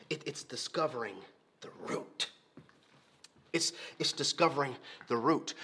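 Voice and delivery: hushed emphasis. Real, not acted. Hushed stress on root.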